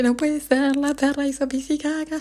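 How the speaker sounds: high mock-tearful phone plea; imitated crying. Owner calls Ezequiel about the dog's mess, mock crying. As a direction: wail it lightly, replaying your own upset. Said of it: "lo llamé a Ezequiel llorando".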